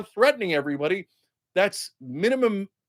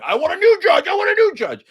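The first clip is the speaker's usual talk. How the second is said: much higher, louder whining; whiny crybaby Trump; 12 semitones higher, 6 dB louder than usual. Retold or imitated. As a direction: demand it like a spoiled child. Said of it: "So he appealed it again".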